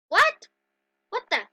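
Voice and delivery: sharp high, loud startled question; jolted into a loud what; surprised what. Real, not acted. Girl startled by something in her game, high loud what.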